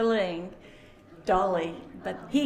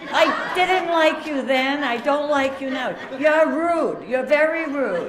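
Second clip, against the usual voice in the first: loud, higher, laughing mock scolding; teasing scold; 5 semitones higher, 8 dB louder than usual. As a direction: tell him off with affection. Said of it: "I said".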